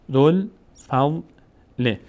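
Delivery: each syllable drawn out, pause between; slow syllable-by-syllable reading. Shown as a demonstration. Teacher models exercise words for students, slow syllable-by-syllable reading. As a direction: sound it out slowly for learners to repeat. Teacher demonstrates joined word slowly.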